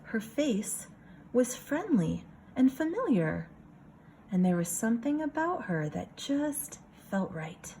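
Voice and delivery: lively picture-book reading voice; story narration. A narrator's reading. Teacher reading a picture book to her class, storytelling voice. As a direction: read it for a room of kids.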